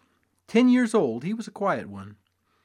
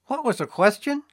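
pale reedy voice; 10 semitones higher, 5 dB louder than usual. A narrator's reading. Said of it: "in a pale, reedy voice".

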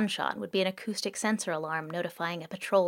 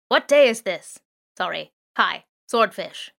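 drone calling out; 8 dB louder, slower than usual. Acted. Drone hails the thieves.

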